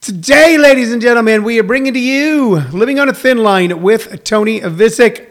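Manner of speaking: booming showman announcer voice; ringmaster. Acted. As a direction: welcome them like a circus ringmaster. Grand show introduction.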